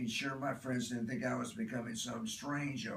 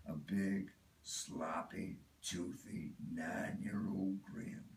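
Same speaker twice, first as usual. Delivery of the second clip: hushed fond memory; 5 semitones lower, 5 dB quieter, slower than usual. Acted. Remembering Donnie's grin to the audience, hushed and tender. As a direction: drop low and soft, almost whispering.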